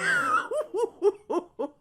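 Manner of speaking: high-pitched burst of laughter. Real, not acted. Laughing at his own Trump hands gesture. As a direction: cackle up high.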